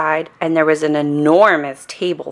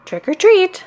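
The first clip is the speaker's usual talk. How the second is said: higher, a bit louder trick or treat; a little louder; 3 dB louder than usual. A narrator's reading. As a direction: call it up high a little louder. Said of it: "He said it a little louder".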